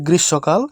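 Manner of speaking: গ্রীষ্মকাল as "grish-sho-kal"; গ্রীষ্মকাল with a silent ম; correct pronunciation. Shown as a demonstration. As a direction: merge ষ and ম into one "sh" in গ্রীষ্মকাল. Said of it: "দুটো মিলিয়ে উচ্চারণটা হবে".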